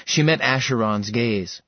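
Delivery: ordinary delivery, nothing marked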